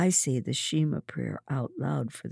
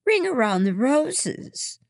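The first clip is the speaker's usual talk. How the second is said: loud high call of a song name; 12 semitones higher, 7 dB louder than usual. A narrator's reading. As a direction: call it out brightly across the room. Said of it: "Mary calls out".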